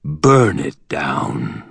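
slow, low, hard command. A narrator's reading. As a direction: give the order coldly and slowly. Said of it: "His gravelly voice came out low and hard".

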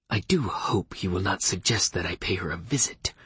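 whispered, stiff and prim; stuffy voice. Acted. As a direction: sniff it out like a fussy old lawyer. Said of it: "Leif said in a stuffy voice".

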